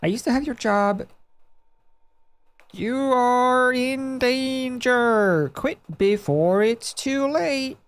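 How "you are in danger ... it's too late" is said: ominous warning. Acted. Mysterious stranger warning.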